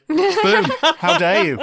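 hammed-up indignant shout; mock outrage. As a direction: act scandalised, loud and laughing.

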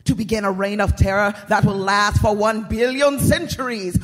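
loud, grandiose villain voice; dark lord. Acted. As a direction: proclaim it like a gloating evil lord. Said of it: "said the Dark Lord".

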